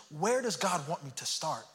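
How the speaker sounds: normal talking voice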